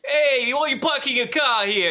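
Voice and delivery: loud, high mock New York yell at a parked car; New Yorker yell. Imitating how he yells at drivers, New York accent. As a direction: bark it like an annoyed New Yorker. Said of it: "my wife claims that I get".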